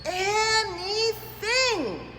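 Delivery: stretched out and loud; big excited shout. Shown as a demonstration. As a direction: belt the word with excitement. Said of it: "I said that loud because the letters are big".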